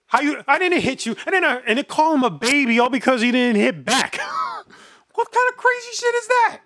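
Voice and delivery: loud, high, shouting disbelief. Real, not acted. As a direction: shout it out, laughing in outrage. Heated argument over celebrity case.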